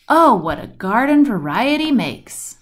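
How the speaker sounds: usual speaking voice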